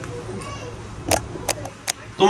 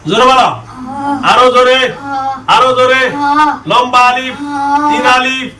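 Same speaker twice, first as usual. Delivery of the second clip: shouting; 6 semitones higher than usual. Real, not acted. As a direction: shout each order. Exorcist drilling loud recitation.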